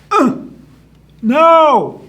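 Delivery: effortful grunt, then high loud no; strain, then yell the answer up; loud, high bullfrog holler; shouted answer. Acted.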